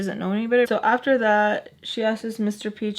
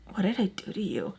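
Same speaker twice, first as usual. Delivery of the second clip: hushed, whispery injured voice; mock wounded; 7 dB quieter than usual. Sam asking what she did, mock-wounded whisper. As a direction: whisper it like the wronged party. Her wounded question, mocked by teller.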